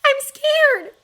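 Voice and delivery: put-on a small girl's fearful plea; scared little girl. A narrator's reading. Sister on thin ice telling her brother she is scared.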